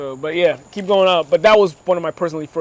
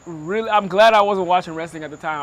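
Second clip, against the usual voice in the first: ordinary delivery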